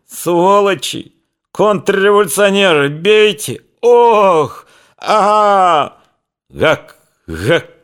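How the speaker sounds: high, broken yells between blows; pained cries. A narrator's reading. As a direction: choke out cries of pain. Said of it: "захлёбывающийся и шматованный болью".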